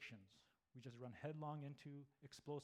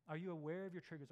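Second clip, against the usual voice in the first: ordinary talk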